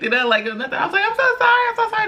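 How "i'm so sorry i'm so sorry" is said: high, pleading so-sorry repeated; mock apology. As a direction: replay your flustered apology, pitched up. Apologizing to midwives, quoted.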